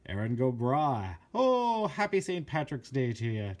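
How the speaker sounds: hammed-up Saint Patrick's cheer; playful Irish cheer. Irish-themed can, playful exclamation.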